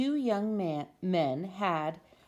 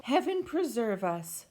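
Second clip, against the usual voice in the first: a higher, fussy neighbor voice; flustered neighbor; 5 semitones higher than usual. Acted. Neighbor shocked by the penguin, in a higher fussy voice. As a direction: gasp it like a shocked old lady. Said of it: "said Missus Callahan".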